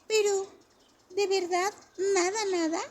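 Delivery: put-on high, loud child disbelief; can't believe it, like a small kid; amazed child. A narrator's reading. A child asks Ben if he truly sees nothing, amazed child voice.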